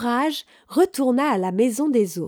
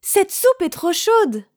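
higher, drawn-out storybook complaint; exclaiming; 9 semitones higher, 3 dB louder than usual. A narrator's reading. Goldilocks tasting soup from the biggest bowl, exclaiming. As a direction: exclaim it slowly, pitched up. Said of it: "elle s'exclama".

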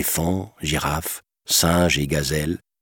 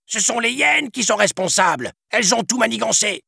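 much higher, rushed pleading shout; cornered villain shouting. A narrator's reading. Trapped villain shouting excuses at the prince, desperate. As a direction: blame others, desperate. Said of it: "Scar hurla".

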